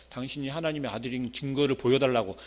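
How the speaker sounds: ordinary talk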